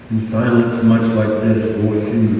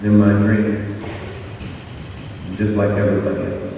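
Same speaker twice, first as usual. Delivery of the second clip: softer and softer until the last line is whispered; fading to whisper; 4 semitones lower, 8 dB quieter, faster than usual. Retold or imitated. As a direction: let the dream slip away into a whisper. Emotional testimony, fading to whisper.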